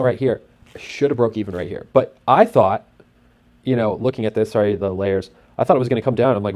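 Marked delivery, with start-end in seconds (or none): none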